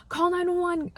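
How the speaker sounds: high-pitched urgent call; shouting for help after the crash; panicked yell. Retold or imitated. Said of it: "and I'm screaming".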